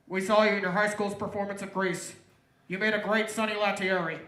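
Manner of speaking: loud, official recruiter voice; FBI agent. Acted. FBI agent recruiting an actor for a fake attack, loud and official. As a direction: project it like an agent giving orders.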